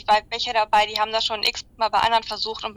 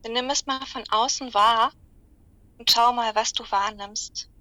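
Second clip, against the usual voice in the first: slow, calm meditation voice; guided meditation; 4 dB quieter than usual. Acted. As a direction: guide them gently into relaxation. Guided animal visualization.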